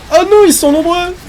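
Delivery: high loud cry of alarm; alarmed shout. Real, not acted. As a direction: yell at the horde. Horde d'ennemis arrive. (cri d'alarme aigu)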